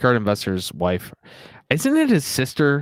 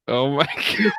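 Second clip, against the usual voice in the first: breaking up with real laughter; words giving way to helpless laughter; laughing hard; 3 semitones higher than usual. Real, not acted. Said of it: "I'm laughing so hard".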